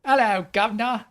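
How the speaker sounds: put-on Cockney, called out; Cockney accent. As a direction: belt out a hammy Cockney hello. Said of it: "not in a Cockney accent".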